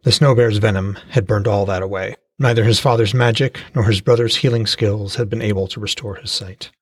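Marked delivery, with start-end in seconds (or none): none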